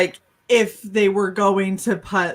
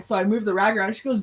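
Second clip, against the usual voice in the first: ordinary talk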